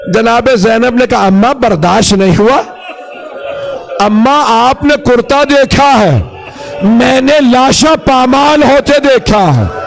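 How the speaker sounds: high, impassioned mourning narration; lament preaching. Acted. Preacher voices Zainab at her mother's grave, lamenting. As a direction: build the grief toward a wail. Lament sermon building toward wail.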